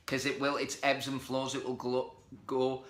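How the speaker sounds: ordinary talk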